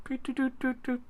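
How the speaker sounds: soft high humming while typing; hummed typing noise. Retold or imitated. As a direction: hum a little tune, doo doo. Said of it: "and he's like".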